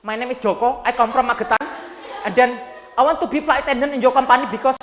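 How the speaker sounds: medok Javanese English, loud and proud; thick Javanese accent. Shown as a demonstration. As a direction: overdo the Javanese accent on purpose. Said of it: "jangan medok juga". (logat Jawa medok)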